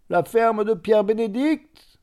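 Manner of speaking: a man shouting to the farriers under the shed; called out. A narrator's reading. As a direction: shout the question to the men. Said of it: "cria-t-il".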